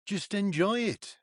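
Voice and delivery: slow, high-pitched coaxing, retold; pleading reassurance. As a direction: imitate a dad gently urging calm, drawn out. Retold dad soothing on the course.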